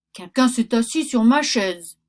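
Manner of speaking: loud, booming papa bear; a big bear grumbling loudly at his porridge; big bear's gruff voice. Acted. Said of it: "dit le grand gros ours de sa grosse voix".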